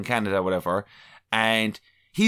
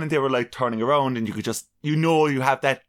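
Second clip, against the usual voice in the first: ordinary talk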